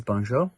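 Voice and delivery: "Bonjour." said in clear French; French greeting. Shown as a demonstration. Scout teaching hello in French, careful pronunciation. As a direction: give "Bonjour." its French sounds. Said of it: "in French is".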